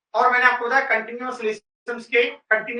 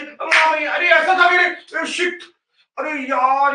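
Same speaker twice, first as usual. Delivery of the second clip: mock frustration; 4 semitones higher, slower than usual. Mimics student forgetting answer.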